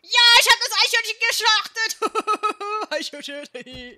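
high, loud triumphant yell; triumphant shout. Real, not acted. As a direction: crow it out in triumph.

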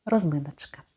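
normal talking voice

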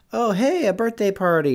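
high-pitched pretend pleasant surprise; mild surprise. Kid showing a small party surprise, mock surprised. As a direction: act mildly surprised, pitched well up. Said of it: "have you ever been surprised".

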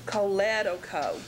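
Choledoco as koh-LED-oh-koh; shifted stress. Shown as a demonstration. Teacher repeating the real pronunciation, Choledoco stressed on the second syllable. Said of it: "it is actually going to be pronounced".

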